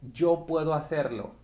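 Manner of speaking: usual speaking voice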